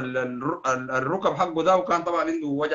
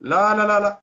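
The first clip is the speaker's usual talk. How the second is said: sharp, loud, higher burst of refusal; 5 semitones higher, 6 dB louder than usual. Real, not acted. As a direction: shut the idea down hard and loud. Angry rejection in live rant. (رفض حاد بصوت عالٍ)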